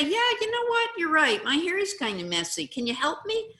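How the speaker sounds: high, bright, loud agreeing; sing-song happy admission; cheerful agreement. Shown as a demonstration. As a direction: gladly own the truth. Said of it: "you say".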